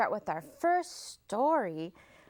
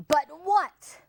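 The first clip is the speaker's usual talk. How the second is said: growled; 7 semitones higher, 7 dB louder than usual. Acted. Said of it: "growled the fox".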